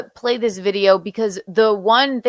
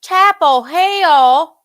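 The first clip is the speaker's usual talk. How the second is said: loud, high, stretched Chapel Hill drawl; Chapel Hill accent; 6 semitones higher, 6 dB louder, much slower than usual. Acted. Imitating the actress for a friend, exaggerated Chapel Hill accent. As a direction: belt out a drawn-out Chapel Hill twang. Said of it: "But she does this Chapel".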